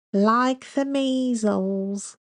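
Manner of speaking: slow, high mock-stuffy English accent; very slow affected English accent. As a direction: drag it out like a pompous English lecturer. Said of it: "she recites in a slow, stuffy English accent".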